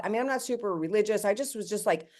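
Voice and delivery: ordinary talk, nothing marked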